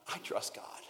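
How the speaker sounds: soft whispered confession; a whisper of quiet trust. Pretend. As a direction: breathe it out as a private vow.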